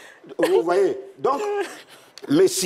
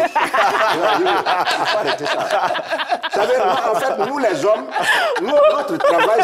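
parle en riant, voix qui monte; lâcher la phrase à travers le rire; fou rire; 7 semitones higher, 4 dB louder, slower than usual. Real, not acted. Raconte en riant sa vie. (rire franc en parlant)

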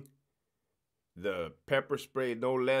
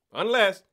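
unless punched higher and louder; stress on unless; 7 semitones higher, 9 dB louder than usual. Shown as a demonstration. Warning officers, he hits "unless" higher and louder. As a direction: lean hard on "unless". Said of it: "to put another emphasis on it".

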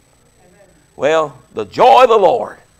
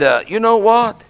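ordinary talk

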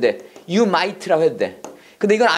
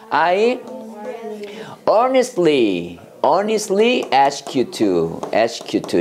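slow model phrase; much slower than usual. Shown as a demonstration. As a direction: draw each syllable out for the class. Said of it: "이렇게 그랬어요".

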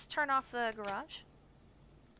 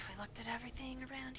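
whisper; 8 dB quieter, faster than usual. Real, not acted. Sneaking, whispers to himself.

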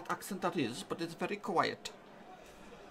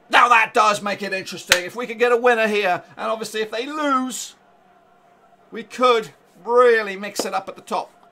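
loud, higher, breathless thrill; riding the high of the goal news; excited; 6 semitones higher, 15 dB louder than usual. Real, not acted. Still buzzing after goal news.

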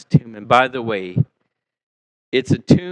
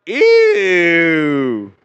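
a higher, louder mock groan of disgust; 3 semitones higher, 5 dB louder than usual. Crowd at the tomb reacting in disgust at the smell. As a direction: pull a face and go ew. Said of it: "everybody's like".

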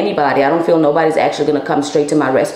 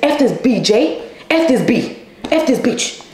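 mocking the boyfriend; 6 semitones higher than usual. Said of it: "He would be like".

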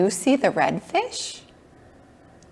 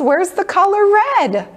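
excited; 12 semitones higher, 6 dB louder than usual. Acted. Searching playfully for kids.

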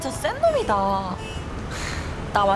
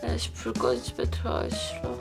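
put-on drunk; 2 semitones higher than usual. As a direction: slur it out like you're plastered. Drunk friend refuses home.

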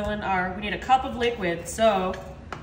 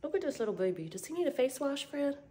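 talking to a dog; 4 semitones lower, 10 dB quieter than usual. Real, not acted. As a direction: coo gently to a puppy.

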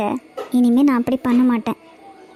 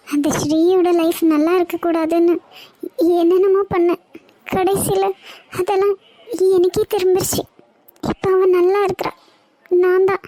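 remorseful; 4 semitones higher than usual. Acted. Runaway regrets wronging Shri, alone.

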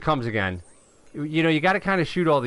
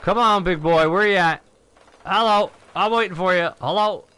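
raised, higher calling voice; 6 semitones higher, 5 dB louder than usual. Pretend. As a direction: playfully call the ship over. Calls to the awaited ship.

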